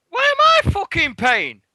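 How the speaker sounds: high, loud outraged protest; indignant shout. Retold or imitated. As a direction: shout it, shocked you're stuck with the bill. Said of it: "and I went".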